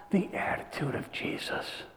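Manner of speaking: tender whispered ending. Real, not acted. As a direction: let it fall to a whisper. Preacher falls to whisper.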